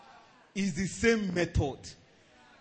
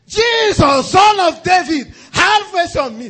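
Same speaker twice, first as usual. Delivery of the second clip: much higher, very loud, slow cry; desperate shout; 10 semitones higher, 13 dB louder than usual. Retold or imitated. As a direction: shout over the crowd, pleading. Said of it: "He shouted".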